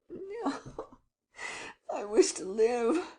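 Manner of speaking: high, sobbing plea. Acted.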